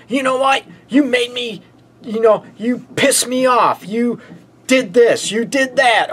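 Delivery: high-pitched mock accusations; attacking a partner. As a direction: act out a partner firing off blame. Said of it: "you start attacking your partner".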